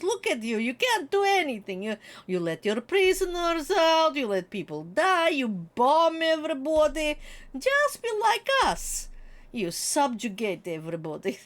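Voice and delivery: put-on lightly Russian-accented scornful rant; mock Putin rant. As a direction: sneer at the West like a lightly voiced dictator. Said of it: "Just sitting there going".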